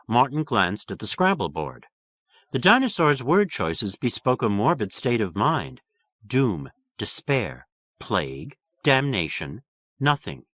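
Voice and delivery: plain reading voice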